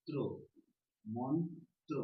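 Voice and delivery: ত্র alone, then মন্ত্র in two beats; syllable by syllable. Shown as a demonstration. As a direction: build মন্ত্র from separate syllables. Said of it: "তারপরে কি বলছি?".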